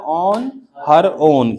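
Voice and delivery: very slow, each word apart; teacher to class: slowly for repeating; slow model phrase. Shown as a demonstration. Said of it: "हर self क्या हो गया".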